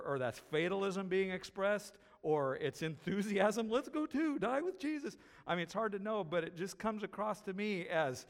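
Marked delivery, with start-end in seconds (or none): 3.7-5.2 much higher, mock-eager Thomas; Thomas quoted. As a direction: toss it off high and eager. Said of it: "he's being sarcastic or".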